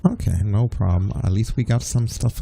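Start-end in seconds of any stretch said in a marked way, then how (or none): none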